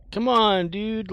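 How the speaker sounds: loud frustrated whine; exasperated shout. Real, not acted. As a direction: yell at the game. Exasperated outburst at game.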